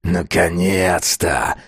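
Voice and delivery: slow impatient growl. A narrator's reading. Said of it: "прорычал тот". (медленно прорычать)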